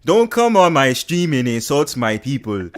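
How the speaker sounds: indignant mock Nigerian accent. Streamer poses as an insulted Nigerian, mock-angry Nigerian accent. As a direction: scold in a put-on Nigerian accent. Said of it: "I am really Nigerian".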